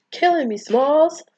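high, loud mock exasperation; exasperated movie quote. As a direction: cry out the catchphrase, stretched and swooping. Famous exasperated movie line quoted.

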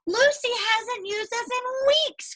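high, slow urgent outcry; crying out. Acted. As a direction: cry it out in alarm. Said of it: "cried the markers".

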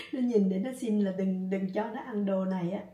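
ordinary talk

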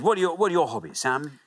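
ordinary talk, nothing marked

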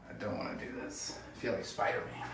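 breathy whisper. Real, not acted. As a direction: whisper it to yourself. Dreading the ice bath.

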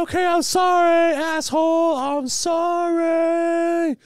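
high, drawn-out shrieking apology; screaming sarcastic apology. Retold or imitated. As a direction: scream the sorry at them. Mocking forced apology, screamed.

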